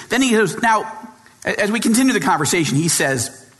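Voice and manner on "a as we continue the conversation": ordinary talk, nothing marked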